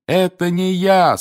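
slow, high, drawn-out denial; exasperated, stretched-out protest; insistent. A narrator's reading. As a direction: insist with weary desperation. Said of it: "снова и снова твердил Гарри".

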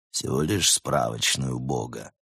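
low, offhand understatement; studied nonchalance. A narrator's reading. Leopard naming his price for the hostages, with studied carelessness. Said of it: "с нарочитой небрежностью сообщил брат Немрод".

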